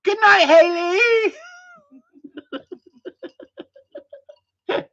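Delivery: hammed-up crying farewell; mock tearful goodnight. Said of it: "she says".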